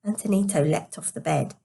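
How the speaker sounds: ordinary delivery, nothing marked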